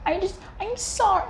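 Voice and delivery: high, slow, pleading cat apology; sorry cat. Retold or imitated. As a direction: whine it like a guilty cat begging. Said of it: "be like".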